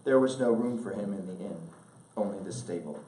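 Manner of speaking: normal talking voice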